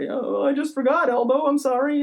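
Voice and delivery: high-pitched put-on excuse; mock apology. Dog walker making excuses to a neighbor, mock apologetic.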